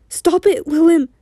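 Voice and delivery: high, pleading shout; shouted, near tears. A narrator's reading. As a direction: shout as if about to cry. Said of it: "he shouted, almost in tears".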